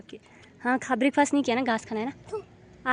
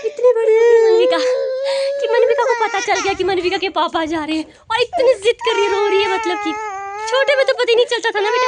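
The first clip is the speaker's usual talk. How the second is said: raised soothing voice over crying; 9 semitones higher, 11 dB louder than usual. Real, not acted. Soothing crying daughter, loud. (रोती बच्ची को ऊँची आवाज़ में समझाती माँ)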